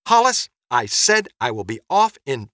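exasperated mother. Acted. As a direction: insist firmly to a pestering husband. Mother snaps at husband mid-quarrel.

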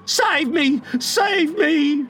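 high-pitched cry for help; high, pleading alarm; desperate cry. A narrator's reading. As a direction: cry out as a frightened boy. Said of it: "cried Nibs".

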